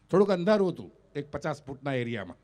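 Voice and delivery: ordinary talk, nothing marked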